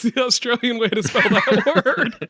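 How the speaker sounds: breaking into a laugh while talking. Real, not acted. Host teasing a listener's spelling, laughing through the words. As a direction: let laughter take over the last words. Joking about listener's misspelling.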